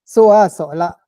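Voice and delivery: "Soa Sola" loud with a rising a; "Soa Sola" with a marked rising tone; tone contrast. Shown as a demonstration. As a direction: lift "Soa" clearly. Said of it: "différence au niveau du ton".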